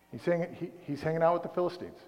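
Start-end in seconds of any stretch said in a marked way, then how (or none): none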